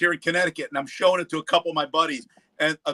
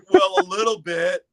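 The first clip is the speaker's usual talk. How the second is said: imitating Gustavo; 11 semitones higher, 4 dB louder than usual. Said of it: "I go".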